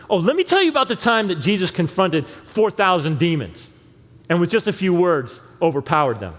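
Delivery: high, loud and eager, pushing the claim; excited Mark. Acted. Mark telling readers about the demons, excited and loud. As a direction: sell the story like a thrilled storyteller. Mark voiced proving his case.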